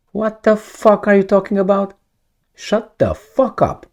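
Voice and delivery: high, scoffing brush-off; mock angry retort. The US rebuffing Mexico's president, high mock scorn. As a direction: bark it back like an offended superpower. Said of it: "Probably would say".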